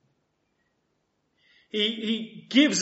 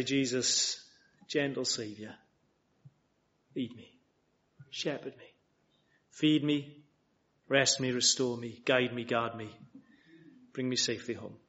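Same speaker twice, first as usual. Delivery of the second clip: a hushed, humble prayer, lower; quiet prayer; 8 semitones lower, 8 dB quieter than usual. Acted. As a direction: pray it gently, as if alone.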